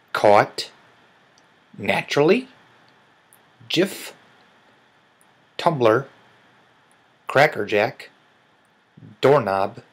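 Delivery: one word at a time with long pauses, each mostly lifting at the end; slow word list. Shown as a demonstration. Speaker pronounces accent-challenge words for viewers, slowly and separately. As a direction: read out isolated words deliberately for listeners to judge. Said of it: "I will say them slowly".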